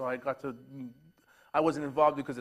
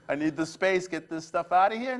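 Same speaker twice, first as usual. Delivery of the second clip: louder mock-demanding outburst; joking, raised-voice order to clear out; facetious; 3 semitones higher, 7 dB louder than usual. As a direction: play a fed-up spouse in jest, loudly. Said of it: "I was being very facetious".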